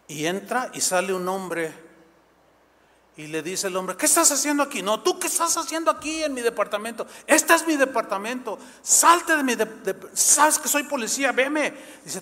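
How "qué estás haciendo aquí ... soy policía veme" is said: angry quarrel. Retold or imitated. Policewoman confronting the man at her door, heated. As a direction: shout the intruder out of her flat.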